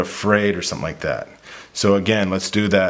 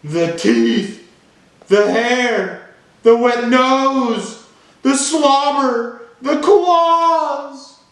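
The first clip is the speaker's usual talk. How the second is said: very high, loud, drawn-out cartoon shriek; panicked pigeon; 6 dB louder, much slower than usual. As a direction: wail each item in horror, stretched and high. Reads the pigeon's panic in the story.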